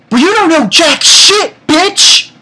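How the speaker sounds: loud, high-pitched scolding; mock tirade. Fan telling off a cat girl, hammed-up indignation. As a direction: let the character have it, hammed up.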